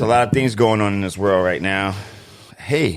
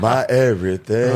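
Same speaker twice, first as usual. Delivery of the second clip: lightly voiced soul crooner; a hint of smooth crooning. Host finishes the love-song line, lightly crooning.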